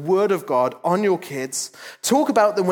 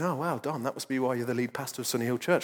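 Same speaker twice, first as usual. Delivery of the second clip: quieter, quick mock-sarcastic aside; mock sarcastic congregation; 7 semitones lower, 6 dB quieter than usual. Pastor voicing a bored listener, mock sarcastic. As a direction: tease yourself as a bored listener would. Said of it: "And you're thinking".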